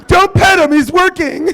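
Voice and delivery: high, loud, panicky passenger pleading; frantic passenger. Acted. Comic as frantic passenger begging the plane crew. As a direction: play a hysterical flyer who lost her pet.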